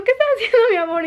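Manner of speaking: high, sing-song ask of what he's doing; sweet question to husband. Retold or imitated. Wife teasingly asks her husband, high and playful. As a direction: lilt it up like teasing your husband. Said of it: "Le digo".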